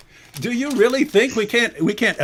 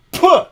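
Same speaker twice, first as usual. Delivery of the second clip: mock spit sound; 9 semitones higher, 5 dB louder than usual. Mimes spitting on the surah.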